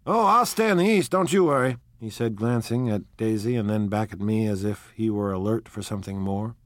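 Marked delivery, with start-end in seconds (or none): 0.1-1.8 loud, high, forceful comeback; cocky, emphatic retort; brash assertion. A narrator's reading. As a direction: boom it out, sure of yourself. Tom brashly answers narrator's challenge.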